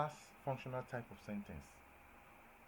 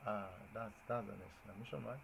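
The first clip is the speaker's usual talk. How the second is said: ordinary talk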